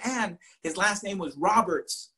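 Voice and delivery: ordinary talk, nothing marked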